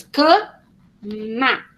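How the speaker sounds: CAMA broken into two slow beats, ca... ma; split into syllables. Shown as a demonstration. Teacher showing a child the two syllables, split into syllables. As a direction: say "cama" syllable by syllable with a clear gap. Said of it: "tem que saber que tem duas sílabas".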